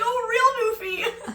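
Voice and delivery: very high, loud squeal of mock surprise; hammed-up exclamation. Imagining mom exposing her lie to strangers, mock-excited.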